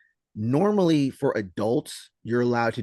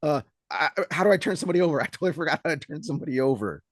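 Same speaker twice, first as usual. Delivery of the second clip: higher, flustered mock panic; panicked player; 7 semitones higher, 4 dB louder than usual. Female player panics in the match, high flustered mock voice. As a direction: play her lost and rattled on the floor. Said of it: "she was a little bit like".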